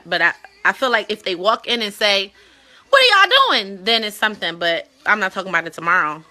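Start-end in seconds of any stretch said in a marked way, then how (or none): 2.9-3.8 mock shocked kid. Kid walks in on parents.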